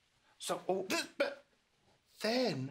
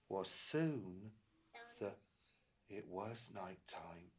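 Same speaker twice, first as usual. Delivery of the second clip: soft, slow bedtime voice; reading to a toddler; 6 semitones lower, 9 dB quieter, slower than usual. A narrator's reading. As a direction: wind down gently for sleep. Bedtime narration.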